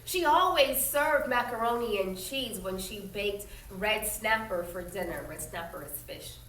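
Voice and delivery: reading a picture book aloud to the class; steady storytelling voice for children; story narration. A narrator's reading. Teacher reading a history story aloud to her students, in a clear storytelling voice.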